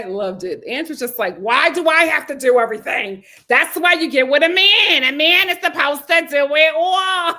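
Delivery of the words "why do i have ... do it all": loud, rising impression of an exasperated woman; mock loud complaint. Host quotes a late reality star griping about men, mock complaint. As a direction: belt it out like a hammed-up grievance.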